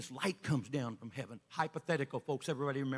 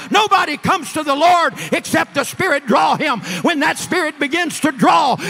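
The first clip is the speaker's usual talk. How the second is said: loud, high, fervent preaching, slowing on key lines; preaching at full force; 9 semitones higher, 18 dB louder than usual. Acted. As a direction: build the church into a roaring appeal. Preaching climax to the congregation.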